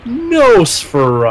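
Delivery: slow, high vampire voice; Nosferatu voice. Retold or imitated. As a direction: draw it out like Nosferatu. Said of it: "it's like".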